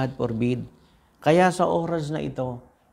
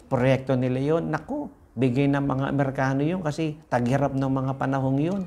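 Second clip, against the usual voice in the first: ordinary talk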